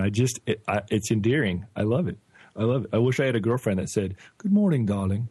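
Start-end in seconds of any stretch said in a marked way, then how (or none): 4.4-5.3 put-on British accent. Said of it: "not making fun of your accent".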